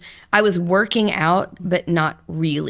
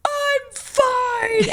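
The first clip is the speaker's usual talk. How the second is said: high breaking voice, choking out the words; sobbing through a brave "I'm fine"; crying. Retold or imitated. Patient to her oncologist, crying as she insists she's fine. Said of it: "And I started just bawling".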